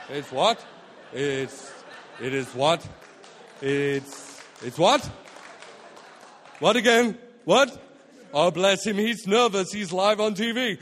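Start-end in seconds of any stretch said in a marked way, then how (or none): 0.1-5.1 lower, tongue-tied mock nervous stammering; baffled stammer with louder rising questions. Nervous Ben stammering live on TV. 6.6-7.8 louder, confused shouted questions; mock bewildered outburst on air; loud baffled what. Confused on live TV.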